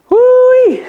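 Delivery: very high, loud whooping woo-wee; imitating a YouTuber's loud tasting whoop; woo-wee exclamation. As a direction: whoop it like a food reviewer. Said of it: "He used to eat something and be like".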